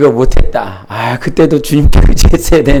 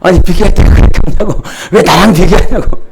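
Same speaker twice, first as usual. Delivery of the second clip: fast, heated protest, re-enacted; acted-out indignant complaint; retold angry outburst; 5 semitones higher, 5 dB louder than usual. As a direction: snap back as if offended, imitating the quarrel. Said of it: "제가 막 벌 화를 냈습니다".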